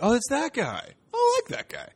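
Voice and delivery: high, bright sing-song oh it's that guy; mock delighted recognition. Comic watching [name] host SNL, squealing high recognition. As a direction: squeal it like spotting someone you know. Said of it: "that was my little reaction".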